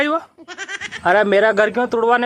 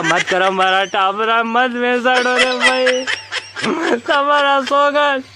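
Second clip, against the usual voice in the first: pleading villager; 3 semitones higher, slower than usual. Acted. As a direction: beg him slowly, then swear on his life. Caller begging not to phone.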